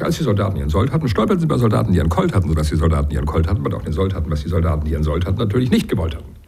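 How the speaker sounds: rattled off quickly; fast tongue twister. Shown as a demonstration. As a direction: race through the twister. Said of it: "ein Beispiel bringen". (schnell heruntergerattert)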